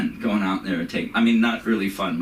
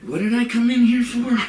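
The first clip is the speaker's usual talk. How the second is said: much higher, louder befuddled voice; mock confusion; 14 semitones higher, 5 dB louder than usual. Comedian imitating himself forgetting during sex, higher and louder. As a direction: play the forgetful old man mid-act. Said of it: "And I'm like".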